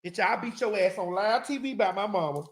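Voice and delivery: ordinary delivery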